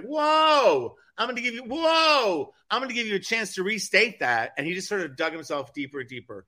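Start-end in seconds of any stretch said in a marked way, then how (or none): 0.0-4.5 imitating startled reporter. Imitating a reporter halting the interviewee, startled and high. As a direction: stop someone short with a high, stretched cry. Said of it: "the reporter was like".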